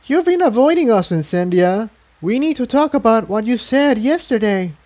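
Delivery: put-on high, firm young mare demanding a talk; a small pony voice standing her ground; Tiptoe confronting. A narrator's reading.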